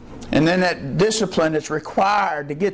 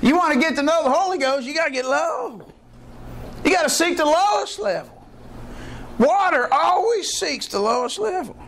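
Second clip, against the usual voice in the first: high, fervent; high fervent preaching; laughing preacher's fervour; 8 semitones higher than usual. Real, not acted. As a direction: laugh then drive the point home. Preacher delighted by water illustration.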